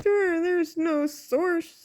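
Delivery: slow, high dopey imitation; mocking denier. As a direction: mimic a dim naysayer, drawn out. Mocking people who deny the source.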